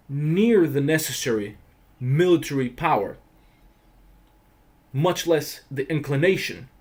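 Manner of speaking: loud, slow and emphatic; heated rant. Real, not acted. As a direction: hammer out each phrase in disbelief. Heated rant about invading Ukraine.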